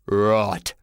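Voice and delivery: gruff porter losing his temper; low, forceful growl; angry yell. Acted. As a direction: bark it low at cheeky kids. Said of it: "yelled the porter".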